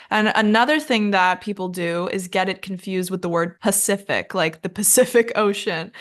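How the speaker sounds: normal talking voice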